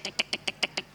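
soft unvoiced tick tick tick; woodpecker ticking. Imitating the nagging woodpecker in your head, soft ticking. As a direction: tap it out like a little woodpecker. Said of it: "woodpecker is in your head going tick".

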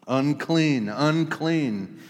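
loud cry of unclean; crying out to the scattering crowd; yelled warning. Acted. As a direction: call it out like a leper warning the crowd. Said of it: "he yells out".

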